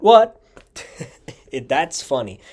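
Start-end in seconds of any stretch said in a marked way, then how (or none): 0.0-0.4 a high, loud, startled what; sudden loud what. Retold or imitated. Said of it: "our out of sync whats". 0.6-1.5 short breathy laugh. Real, not acted. Laughs at own retelling.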